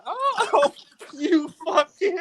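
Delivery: words slowed, broken by real laughter; laughing. Real, not acted. Real laughter at the caller's reveal, words slowed.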